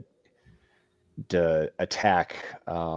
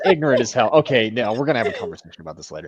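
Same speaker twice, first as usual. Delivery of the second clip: louder, hammed-up indignation at a friend; mock outrage; 5 semitones higher, 9 dB louder, faster than usual. Teasing a friend who hates world building, mock indignant. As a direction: play up fake fury over world building.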